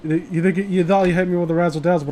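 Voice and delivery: usual speaking voice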